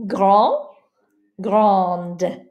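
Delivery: Grand with silent D, then grande with D sounded; final D contrast. Shown as a demonstration. Teacher contrasting masculine and feminine forms. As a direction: model the pair, voicing the second D. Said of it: "you say the T".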